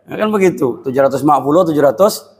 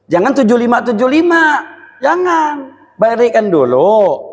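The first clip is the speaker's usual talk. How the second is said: higher, rising forbidding call; preacher's insistent warning; emphatic warning; 6 semitones higher than usual. Real, not acted. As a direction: forbid it firmly, pitch climbing. Preacher warning against equal split.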